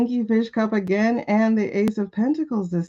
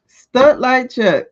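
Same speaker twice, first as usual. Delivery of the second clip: slow excited; 4 semitones higher, 6 dB louder, much slower than usual. Real, not acted. Reacting to a card reappearing.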